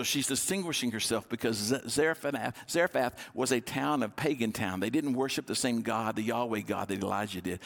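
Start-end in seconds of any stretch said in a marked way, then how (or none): none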